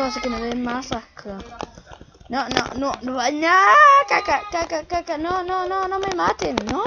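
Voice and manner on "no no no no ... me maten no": panicked pleading. Real, not acted. Under attack, pleading.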